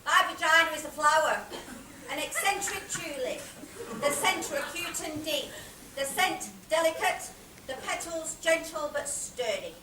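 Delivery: loud, measured poetic recital; poetic declamation. Acted. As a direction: proclaim each image in short, separate phrases. Poetic opening of staged monologue.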